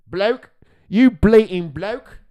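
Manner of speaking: loud, high, slow mock British; hammed-up British accent, drawn out. Reactor mocking a British man's talk, exaggerated British accent. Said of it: "why are you talking like that".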